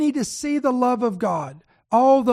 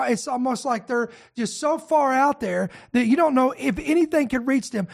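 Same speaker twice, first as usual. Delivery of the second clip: ordinary talk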